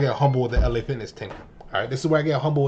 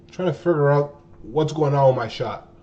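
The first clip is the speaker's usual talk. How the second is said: normal talking voice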